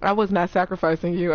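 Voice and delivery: usual speaking voice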